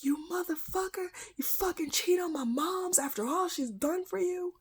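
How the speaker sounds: outraged outburst retold high in the voice; imitated shouting brother. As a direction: act out an enraged brother confronting a cheater. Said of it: "and my brother shouting".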